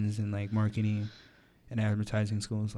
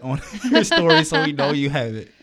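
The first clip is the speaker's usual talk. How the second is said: laughing; 3 semitones higher, 7 dB louder than usual. Real, not acted. Joking about posting proof.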